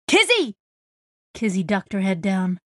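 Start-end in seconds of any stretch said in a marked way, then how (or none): 0.1-0.5 high shout of her name. A narrator's reading. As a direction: yell to get her attention. Shouting over loud music.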